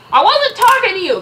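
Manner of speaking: loud high sharp retort; irritated loud snap; snapped. Acted. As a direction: snap it at him. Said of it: "Captain America snapped".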